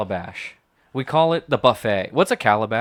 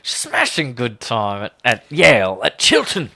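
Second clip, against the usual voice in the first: hammed-up grandfather, growing louder; Dick Gilmore; 6 dB louder, slower than usual. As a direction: declaim it as a proud mock grandpapa. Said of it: "Me, Dick Gilmore? A grandfather?".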